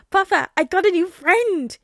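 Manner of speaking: put-on high, slow, loud child voice, thrilled; excited little boy. A narrator's reading. Son tells his father about his new friend, excited child. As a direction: announce it like the best news ever. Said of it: "he exclaims".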